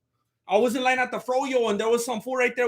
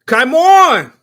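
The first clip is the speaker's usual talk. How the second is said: shouted, higher and louder; loud cheer; 4 semitones higher, 12 dB louder than usual. Real, not acted. Streamer hyping the fight on stream, loud shout. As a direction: yell it at the screen.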